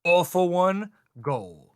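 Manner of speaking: loud, high drawn-out battle cry; acted attack call. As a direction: announce the big move, stretching it out. Acts out the villain's attack call.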